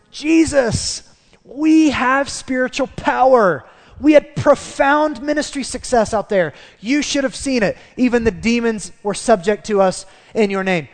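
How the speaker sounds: high, loud, triumphant report; boastful excitement. Acted. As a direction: brag to your teacher about a big win. Acting out boastful returning disciples.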